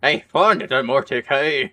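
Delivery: loud, slow Scottish brogue; Scottish accent. Retold or imitated. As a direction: call it out in a hammy Scottish accent. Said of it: "I'm just making him Scottish".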